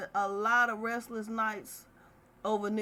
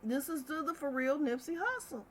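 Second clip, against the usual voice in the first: amazed realisation; 5 semitones higher, 5 dB quieter than usual. Retold or imitated. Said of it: "I'm like".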